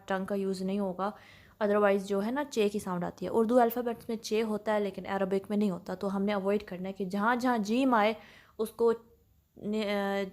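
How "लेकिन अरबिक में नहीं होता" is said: ordinary talk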